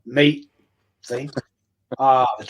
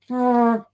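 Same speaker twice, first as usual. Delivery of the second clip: dog fart noise; 10 semitones higher than usual. Retold or imitated. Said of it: "the dog looks at them and goes".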